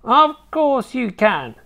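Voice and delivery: high, slow scolding grandma; snapped retort. A narrator's reading. Grandma answering her grandson's protest, snappish. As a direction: snap it back in a high old woman's voice. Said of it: "she snapped".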